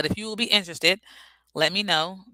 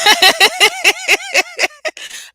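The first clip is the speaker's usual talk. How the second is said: high loud laugh; 12 dB louder than usual. Real, not acted. Laughs after accent joke.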